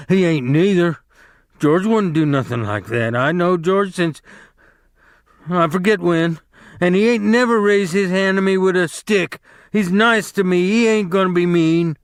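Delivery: high, loud stubborn defiance; belligerent retort. A narrator's reading. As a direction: fight back, defending George. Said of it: "Lenny retorted belligerently".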